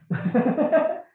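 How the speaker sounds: loud, high rising laugh; amused laugh. Real, not acted. Amused by his own remark.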